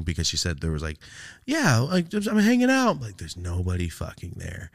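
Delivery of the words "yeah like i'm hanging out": put-on little kid voice. Sister as a little child.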